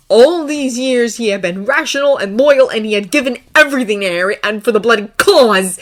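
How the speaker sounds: loud, heated, mounting storytelling; impassioned narration. A narrator's reading. Narrator voicing Draco's resentment, impassioned loud narration. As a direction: narrate with rising outrage.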